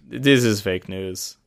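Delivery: put-on glum, slow Russian accent, low and soft; depressed Russian accent. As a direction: mope through it in a Russian accent. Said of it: "Yeah, that's good".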